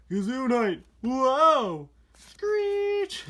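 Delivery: high, loud, squealing kazoo cries; hammed-up twin voices. Reviewer acting out the tuners' comic panel, high and loud. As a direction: shriek the lines like excited tuner cars. Reading twins' lines in voices.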